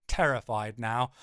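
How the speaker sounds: ordinary delivery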